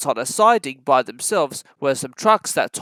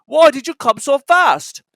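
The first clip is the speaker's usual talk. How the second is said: stern questioning; 8 semitones higher, 8 dB louder than usual. Acted. Director questioning.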